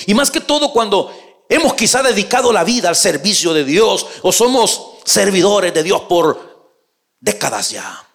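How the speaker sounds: normal talking voice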